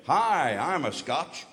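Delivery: mock American tourist greeting; American accent. American claiming Scottish roots in a hotel, mock American accent. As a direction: introduce yourself with a caricatured American accent. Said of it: "full of Yanks".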